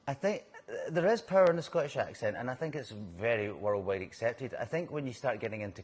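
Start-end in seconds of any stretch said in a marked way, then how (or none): 0.9-2.4 IS punched higher and louder; stress on IS. Real, not acted. Answering whether the accent has power, stress on IS. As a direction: lean on "is" to affirm it firmly. Answers question with emphatic agreement.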